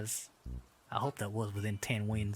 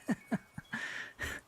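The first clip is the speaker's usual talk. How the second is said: quiet, voiceless burst of laughter; breathy laugh; 5 dB quieter than usual. Real, not acted. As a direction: laugh softly on mostly air. Reacting to a surprise raid.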